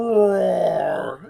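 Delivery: loud growling roar; tiger roar. Acted. Storybook tiger Clovis appears, big loud roar.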